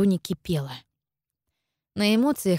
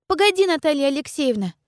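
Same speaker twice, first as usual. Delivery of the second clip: put-on high, firm girlish interruption; cutting in; 8 semitones higher, 3 dB louder than usual. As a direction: cut her off as a young girl. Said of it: "оборвала её девочка".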